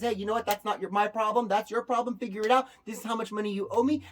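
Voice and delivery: loud, fast brush-off; dismissive state. Retold or imitated. The state brushing off the business owner, loud and dismissive. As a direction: shove the bill at them. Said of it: "The state says".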